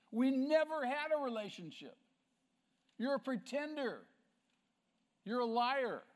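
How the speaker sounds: high, loud, condemning verdict; acted-out divine denunciation, hammering each charge; thundering rebuke. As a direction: banish them with full force.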